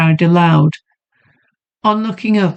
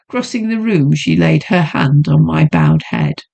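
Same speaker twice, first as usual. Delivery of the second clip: ordinary delivery, nothing marked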